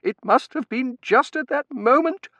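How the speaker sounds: high, loud, mounting agitation; voice rising. A narrator's reading. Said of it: "she said, her voice rising".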